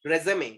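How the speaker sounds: resume as "re-zuh-may", falling; accented résumé. Shown as a demonstration. Teacher pointing to the accent mark, resume as "re-zuh-may". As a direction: sound the accented last vowel of resume, "may". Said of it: "मैंने acute accent लगाया है".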